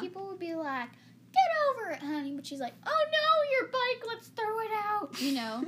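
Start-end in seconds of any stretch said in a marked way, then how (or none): 1.3-2.3 loud, high mock southern accent; hammed-up southern mom. Shown as a demonstration. Showing how southern people would say it, exaggerated southern accent. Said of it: "Or, like, in southern". 2.9-5.2 very high, hammed-up mock dismay; loud, breezy clueless-mother voice; oblivious mom. Mom reacting to the broken bike, high mock dismay. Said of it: "She's that kind of mom".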